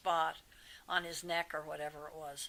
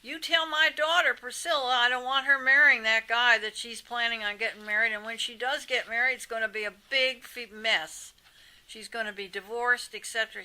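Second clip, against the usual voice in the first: a hint of a stern rock-star father; 3 semitones higher, 9 dB louder than usual. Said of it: "He said".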